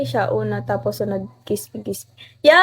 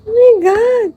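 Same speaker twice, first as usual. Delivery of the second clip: excited scream; 9 semitones higher, 8 dB louder than usual. Real, not acted. Reacts to huge gift on stream.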